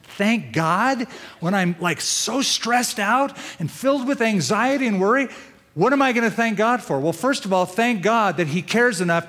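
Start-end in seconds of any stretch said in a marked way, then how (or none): 0.0-7.0 higher, disbelieving complaint; mock stressed-out protest; lightly voiced anxious listener; incredulous objection. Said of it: "I know you might be going".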